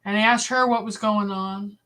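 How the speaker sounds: ordinary talk, nothing marked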